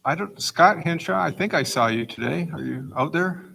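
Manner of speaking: ordinary talk, nothing marked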